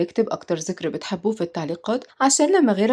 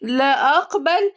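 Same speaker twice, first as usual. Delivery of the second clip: slow, higher mocking echo of "لا أقبل"; stretched, rising, sarcastic "لا أقبل"; 6 semitones higher, much slower than usual. A narrator's reading. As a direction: throw it back as a drawn-out question. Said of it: "ردت مامتها بسخرية".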